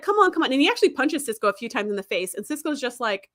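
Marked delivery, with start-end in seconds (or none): 0.0-0.5 higher taunting call; goading him to fight. Retold or imitated. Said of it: "And he's like".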